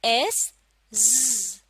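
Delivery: एस then ज़ drawn out slowly; slow sound demo; slow z blend. Shown as a demonstration. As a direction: stretch the ज़ buzz. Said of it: "E silent".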